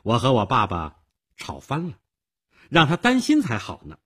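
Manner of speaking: put-on high, loud girlish defiance; haughty girl. A narrator's reading. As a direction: toss it off like a defiant, arrogant girl. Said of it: "女孩傲慢地说着".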